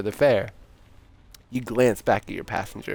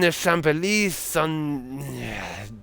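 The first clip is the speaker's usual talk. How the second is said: put-on slow, higher-pitched drunken slur; drunk French Santa; 4 semitones higher, slower than usual. Santa fumbling the street name to the driver, drunkenly drawn out. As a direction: lose the thread like a tipsy Santa. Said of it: "it's a drunk French Santa".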